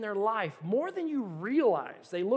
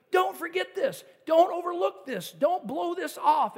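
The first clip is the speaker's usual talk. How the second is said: higher, louder, slowing emphatic plea; urgent warning; 9 semitones higher, 7 dB louder than usual. Retold or imitated. Preacher voicing Jesus warning the crowd, loud and urgent. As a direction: hammer the warning home. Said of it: "Jesus is like".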